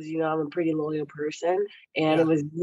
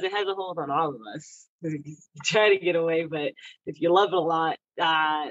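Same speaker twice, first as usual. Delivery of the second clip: normal talking voice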